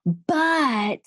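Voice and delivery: "But" stretched out long and high; really emphasized but. Acted. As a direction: hit "But" hard as a loaded objection. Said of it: "it's really emphasized".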